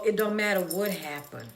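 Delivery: ordinary talk, nothing marked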